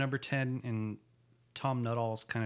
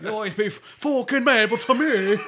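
put-on drunken Irish; 11 semitones higher, 11 dB louder than usual. Quoting drunk Tim Driscoll.

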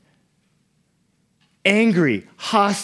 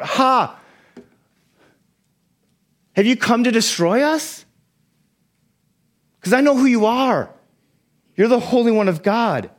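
higher, half-laughing cry of a cornered demon; confused demon crying out; 5 semitones higher than usual. Acted. Demon cries out at Jesus in confusion, high and mocking. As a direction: play it like a scared, wounded animal lashing out. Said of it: "he cries out".

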